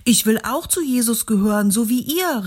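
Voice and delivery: a stranger calling out, voice a bit altered; a new voice from the crowd, eager; ein Fremder ruft. A narrator's reading. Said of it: "rief einer". (ein Fremder aus der Menge ruft eifrig)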